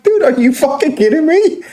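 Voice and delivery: indignant retort. Retold or imitated. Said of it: "I'm like".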